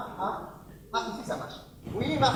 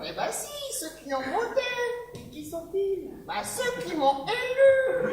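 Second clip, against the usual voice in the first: high squeaky; 12 semitones higher than usual. Acted. Absurd defensive answers.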